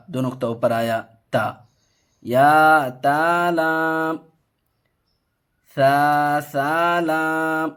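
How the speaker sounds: drawn-out, sing-song reading of letter names; slow letter recitation. Shown as a demonstration. Teacher reading a Qaida lesson aloud, slow sing-song recitation. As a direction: chant each letter slowly for the pupil.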